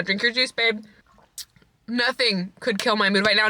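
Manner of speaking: normal talking voice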